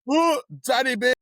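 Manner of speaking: high, loud whoop of the name; a big fake hooray for Danny Beard; mock cheer. Guest cheering a rival on cue. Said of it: "we're gonna say how much we hate Danny Beard".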